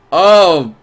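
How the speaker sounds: ordinary talk, nothing marked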